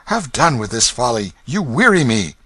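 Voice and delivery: tired of this nonsense; mild show of temper. Acted. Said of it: "replied with temper".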